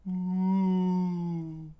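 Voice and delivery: long, high imitated wail; sustained falsetto howl; high drawn-out hoot. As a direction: hold a high hooting 'ooh'. Imitated sound in the story.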